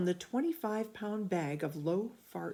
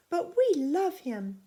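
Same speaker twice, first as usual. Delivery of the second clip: put-on high, slow child's voice pleading; childlike plea; 12 semitones higher, 6 dB louder, slower than usual. A narrator's reading. Betty pleading with mother to keep dog, high and slow. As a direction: plead like a little girl for her dog.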